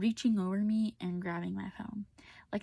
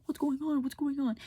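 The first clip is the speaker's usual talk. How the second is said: higher-pitched retold alarmed question; mock alarm; 6 semitones higher, 4 dB louder than usual. Boyfriend walking in on the fight, mock alarm. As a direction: imitate him in a higher voice.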